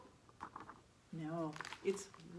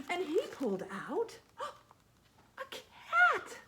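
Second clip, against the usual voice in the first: higher, louder gasping reveal; excited reveal; 9 semitones higher, 7 dB louder than usual. A narrator's reading. Reader revealing the cat to the children, higher and louder. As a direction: make it a big surprise.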